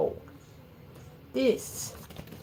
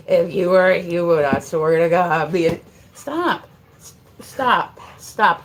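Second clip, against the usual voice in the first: loud rant breaking into commands; real fed-up outburst; exasperated scolding; 9 dB louder than usual. Real, not acted. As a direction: rail at the dog, voice raised. Exasperated threat to the dog.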